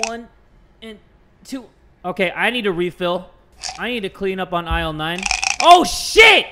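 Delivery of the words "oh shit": loud, high-pitched swearing; startled shout. Real, not acted. Player botching the pills, startled shout. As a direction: blurt it out at full volume. Pill mishap in the game.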